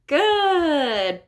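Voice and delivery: bright, high "Good"; cheerful praise. Shown as a demonstration. Therapist cheering a child's word.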